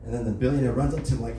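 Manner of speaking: normal talking voice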